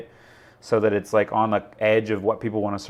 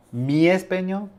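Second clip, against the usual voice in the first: put-on Spanish accent; 6 semitones higher than usual. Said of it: "talking about my español".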